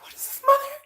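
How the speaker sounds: hammed-up aghast question; mock pearl-clutching shock. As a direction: imitate appalled kids in a squeaky pitch. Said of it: "They were clutching the pearls".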